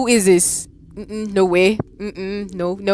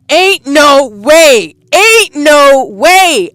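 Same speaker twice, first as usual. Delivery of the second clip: high, loud, very slow "Ain't no way"; slow panicked disbelief; 8 semitones higher, 9 dB louder, much slower than usual. Real, not acted. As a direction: drag it out in dread. Panicked disbelief after scare.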